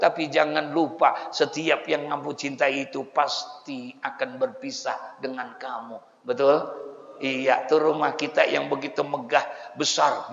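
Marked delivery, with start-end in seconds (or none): none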